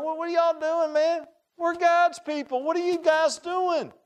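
put-on high, slow, indignant boy's voice; boy scolding the frightened army; young David. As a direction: challenge them like a bold youngster. David confronts fearful army.

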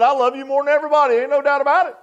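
high, fast mock boasting; bragging. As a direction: crow it with overblown confidence. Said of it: "afraid to brag and say".

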